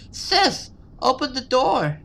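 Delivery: high, put-on little girl voice calling through the door; a creature faking a child's sweet plea; eerie little sister. Monster mimics little sister's voice.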